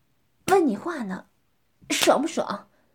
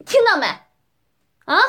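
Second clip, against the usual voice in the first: sharp shouted demand; 3 semitones higher, 5 dB louder, faster than usual. Acted. Dominant woman demanding an answer from her partner, shouted. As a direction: snap it out as a demand.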